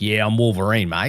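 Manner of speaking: broad Australian Wolverine; mock Australian accent. Joking about the actor's accent slipping, mock Australian accent. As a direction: play Wolverine as an obvious Aussie. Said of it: "as an Australian watching it".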